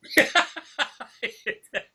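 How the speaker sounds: high-pitched burst of laughter; laughing. Real, not acted. Laughs at own mutant remark.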